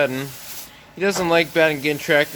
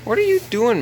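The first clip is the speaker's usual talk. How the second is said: high pet-talk question; 10 semitones higher, 3 dB louder than usual. Real, not acted. Addressing a calf at the gate.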